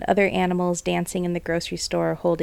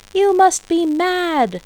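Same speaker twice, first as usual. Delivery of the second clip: high, loud, slow mockery; jeering; 10 semitones higher, 5 dB louder, slower than usual. A narrator's reading. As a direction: jeer at the farmers. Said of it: "The people jeered and laughed".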